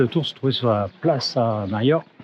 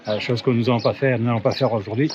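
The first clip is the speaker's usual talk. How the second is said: usual speaking voice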